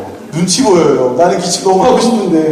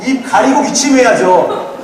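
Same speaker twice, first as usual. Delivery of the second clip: put-on higher, drawn-out child's reprimand; scolding kids imitated; 7 semitones higher, much slower than usual. Pastor imitating his children scolding him for coughing, drawn-out mock nagging. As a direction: nag dad like a little kid. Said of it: "갑자기 그걸 어떻게 하면".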